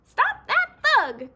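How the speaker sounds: very high, loud, slow yell; angry cry. Acted. Birds calling after the fleeing fox, loud high yell. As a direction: yell to stop him. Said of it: "cried the birds".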